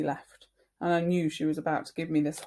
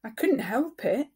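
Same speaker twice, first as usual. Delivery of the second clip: whining; 6 semitones higher, 4 dB louder than usual. Acted. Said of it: "kind of whining".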